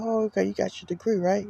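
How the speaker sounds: a hint of a patronizing woman checking up on him; mock woman's voice. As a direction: lightly voice the woman as a condescending quiz. Mocking woman's question at university.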